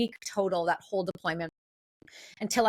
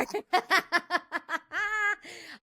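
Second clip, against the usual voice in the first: laugh; 8 semitones higher than usual. Real, not acted. Laughs at the threat.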